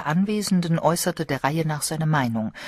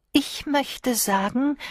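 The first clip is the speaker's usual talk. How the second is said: put-on slow, higher, gentle old lady; thoughtful; 9 semitones higher, slower than usual. A narrator's reading. Old Miss Marple thoughtfully contradicting the guests, slow. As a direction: weigh each word calmly. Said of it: "bemerkte Miss Marple nachdenklich".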